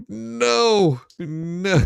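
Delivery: ordinary talk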